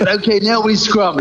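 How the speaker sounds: mock chef. Giant chef voiced in story.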